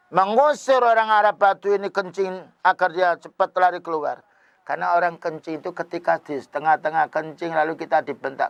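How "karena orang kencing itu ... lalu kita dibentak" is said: usual speaking voice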